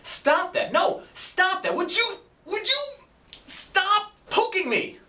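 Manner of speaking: "Stop that" in a high, rising pitch, then a slow, drawn-out plea; exasperated protest. Shown as a demonstration. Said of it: "you're allowed to go".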